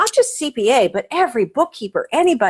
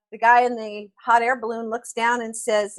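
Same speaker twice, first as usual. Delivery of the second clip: ordinary delivery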